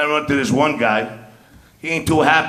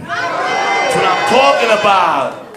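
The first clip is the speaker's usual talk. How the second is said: crowd greeting; 5 semitones higher, much slower than usual. Real, not acted.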